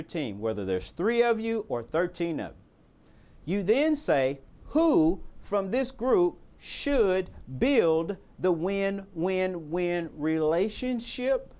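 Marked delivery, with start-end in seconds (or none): 4.7-11.5 slow, drawn-out words, each given weight; deliberately slow. Shown as a demonstration. Coach tells the team the next key step, slowly. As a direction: spell it out slowly, word by word. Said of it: "I wanna say it slowly".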